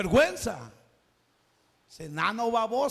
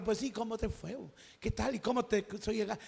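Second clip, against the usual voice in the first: casual chat; 9 dB quieter, faster than usual. Acted. Jesus chatting casually with woman.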